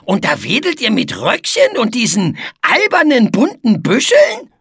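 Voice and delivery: high, scornful character voice rising sharply at the end; disdainful, incredulous put-on voice; sniffy scorn. A narrator's reading. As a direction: sneer at it with a climbing question. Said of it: "schniefte Etze".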